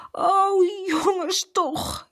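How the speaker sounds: high, drawn-out lament; wailing. A narrator's reading. Mama to the twins after the crash, high wailing. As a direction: wail in dismay at the children. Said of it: "jammerde mama".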